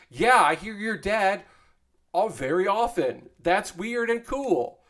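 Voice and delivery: high-pitched, drawn-out retold enthusiasm; retold excitement. Streamer replays his reply to his intern, excited. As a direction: act out being impressed, pitch well up.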